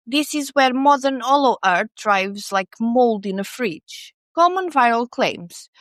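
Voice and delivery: usual speaking voice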